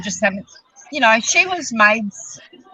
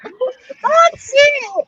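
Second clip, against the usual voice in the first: excited shout. Real, not acted. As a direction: shout it out, thrilled by the idea. Excited by the kidnapping knitters idea.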